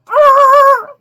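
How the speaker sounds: sudden high loud ah; startled cry. Real, not acted. Watching the bull charge, a startled cry.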